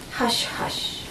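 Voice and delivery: soft unvoiced shushing; a whispered hush, hush. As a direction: breathe it like soothing a child. He hushes her with fingers.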